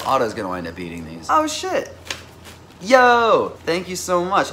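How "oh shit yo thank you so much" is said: high, loud thrilled thanks; overjoyed yelp then thanks; excited squeal. Real, not acted. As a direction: burst out with delight. Delighted by a gift.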